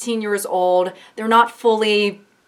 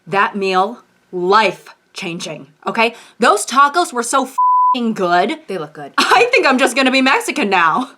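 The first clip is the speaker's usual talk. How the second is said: hammed-up taco fan; mock customer; 2 semitones higher, 4 dB louder than usual. A customer in a skit raving about tacos, loud and gushing.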